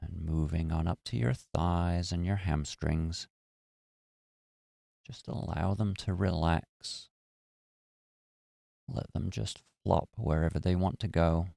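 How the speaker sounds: calm, even meditation voice with long pauses; guided meditation. Acted. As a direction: guide listeners gently through relaxing the body. Leads a guided body-relaxation meditation.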